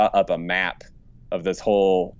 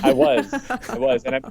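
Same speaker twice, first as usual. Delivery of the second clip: Joker-like vampire voice. Real, not acted.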